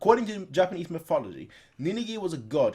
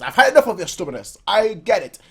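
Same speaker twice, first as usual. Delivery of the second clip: scolding rider; 4 semitones higher, 8 dB louder than usual. Acted. Voicing rider scolding the horse.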